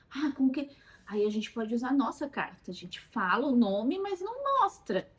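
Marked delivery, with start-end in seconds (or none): none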